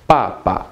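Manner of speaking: "Papa" falling sharply, then short and light; falling-then-light tones on "Papa"; fourth tone then neutral. Shown as a demonstration. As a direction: drop hard on the first syllable of "Papa", second unstressed. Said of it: "Fourth tone, then neutral".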